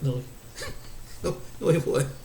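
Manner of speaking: mock denial broken up by laughter; laughing denial. Woman denying a man's best-lover boast, laughing.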